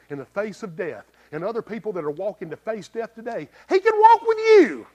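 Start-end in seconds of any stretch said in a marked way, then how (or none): 3.7-4.9 climactic shout. Acted.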